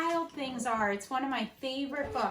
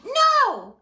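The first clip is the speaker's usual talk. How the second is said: much higher, louder flat refusal; firm no; 7 semitones higher, 11 dB louder than usual. Acted. Max to the wild things, loud firm refusal. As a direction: refuse them outright. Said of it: "And Max said".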